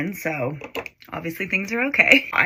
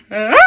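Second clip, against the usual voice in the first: imitated hysterical crying; 3 semitones higher, 5 dB louder than usual. Retelling her meltdown to viewers, mock hysterical crying. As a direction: act out a bawling fit with a gasp and a wail. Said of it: "I just start hysterically crying".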